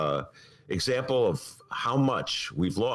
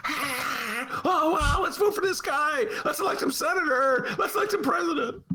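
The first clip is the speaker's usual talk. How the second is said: very high, gushing; mock swooning voter. Host mocking Obama fans, very high gush. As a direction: squeal like a starstruck fan.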